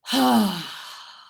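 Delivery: big audible release sigh, falling; a loud, forceful exhale to let go. Shown as a demonstration. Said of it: "It's a really audible, loud, forceful sigh".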